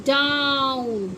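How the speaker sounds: डाउन stretched long and slow; drawn-out down. Shown as a demonstration. Teacher models down slowly for her pupils. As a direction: hold the ow in डाउन. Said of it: "T O W N".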